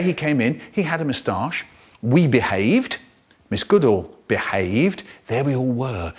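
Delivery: ordinary delivery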